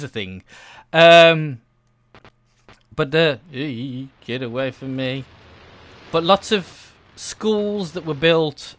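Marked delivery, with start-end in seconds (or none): none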